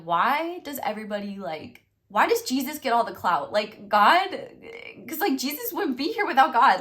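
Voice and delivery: mock outrage at Jesus getting the credit; exasperated childish questioning. Retold childhood confusion about Jesus.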